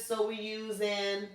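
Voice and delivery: normal talking voice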